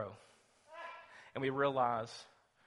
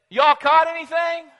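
calling across water; 17 dB louder than usual. Acted.

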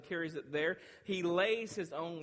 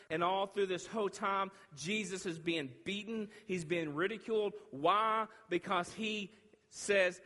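raised, driving sermon delivery; forceful preaching; 6 semitones higher, 5 dB louder than usual. Real, not acted. Preacher telling the congregation of Jesus' trial, forceful. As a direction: preach it with rising urgency.

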